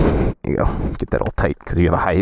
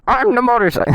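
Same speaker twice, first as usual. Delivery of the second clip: much higher, goofy hammed-up boast; silly voice; 13 semitones higher than usual. Trying the silly voice again for his girlfriend, squeaky. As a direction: squeak it out like a cartoon tough guy. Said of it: "I wanted to do a silly voice".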